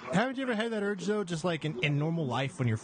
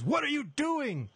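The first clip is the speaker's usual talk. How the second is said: high, slow, alarmed stranger's "what are you doing"; jumpy passerby demanding an answer; mock startled lady; 14 semitones higher, slower than usual. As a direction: play the lady who just leapt out at you, hammed up. Lady jumps out, retold quote.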